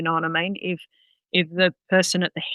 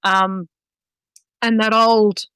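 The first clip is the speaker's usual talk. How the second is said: usual speaking voice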